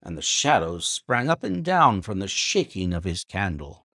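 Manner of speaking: plain reading voice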